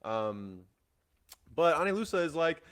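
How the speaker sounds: normal talking voice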